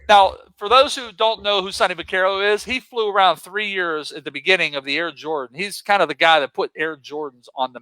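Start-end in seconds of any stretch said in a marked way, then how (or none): none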